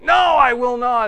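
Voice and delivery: high, loud refusal; emphatic reassertion. Retold or imitated. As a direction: insist forcefully. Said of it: "he reasserts it".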